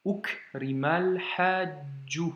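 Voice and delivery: slow "Ukrimal hajju", the l joined on; careful linked reading of "Ukrimal hajju"; with liaison. Shown as a demonstration. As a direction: link "Ukrimal" into "hajju", syllables drawn out. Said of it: "on fait la liaison".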